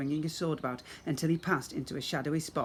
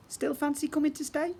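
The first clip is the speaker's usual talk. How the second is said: playful question; 12 semitones higher than usual. Acted.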